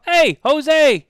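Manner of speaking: high, loud call across the room; excited greeting. Retold or imitated. Calling out to Jose at the airport, excited and loud. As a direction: call out to a friend with delight. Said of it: "I go".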